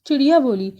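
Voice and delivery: ordinary delivery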